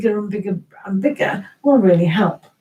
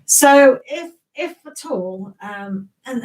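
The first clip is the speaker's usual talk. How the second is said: normal talking voice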